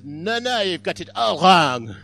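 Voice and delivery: loud, hammed-up French protest; slow down and shout it like a stage Frenchman; mock French accent. Joke's Frenchman contradicting the interviewer, hammed-up French accent. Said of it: "And the Frenchman says".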